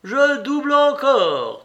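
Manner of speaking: high, loud, drawn-out cry of glee; slow, ringing, happy shout; joyful shout. A narrator's reading. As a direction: call out the bet exultantly. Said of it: "cria joyeusement Mitya".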